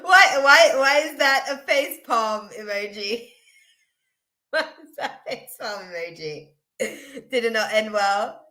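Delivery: high laughing start that settles as it goes; real giggles bubbling between the questions. Real, not acted. Asking a chatter about their face palm emoji, laughing. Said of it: "I'm laughing at Bobby's".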